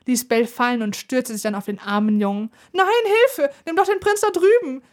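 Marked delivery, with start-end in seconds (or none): 2.7-4.8 much higher, loud panicked cry for help; boy shrieking in panic as the dragon lunges; terrified scream. A narrator's reading. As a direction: scream for your life. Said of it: "schrie er".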